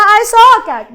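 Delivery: loud, bright "I saw a cat"; loud example sentence. Shown as a demonstration. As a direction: read the example out to the class. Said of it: "या मैंने बोला".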